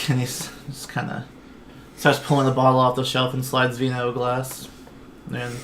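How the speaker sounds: ordinary talk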